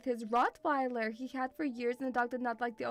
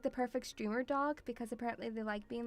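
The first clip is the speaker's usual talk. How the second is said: ordinary talk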